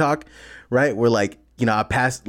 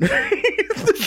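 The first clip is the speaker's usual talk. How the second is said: high burst of laughter. Real, not acted. Friends joking about a challenge, high squealing laugh.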